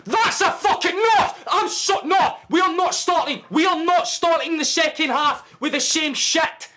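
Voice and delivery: screaming in outrage at the ref; enraged football fan yelling; furious shout. Real, not acted. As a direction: bellow it at the top of your lungs, high and loud. Furious fan at missed handball.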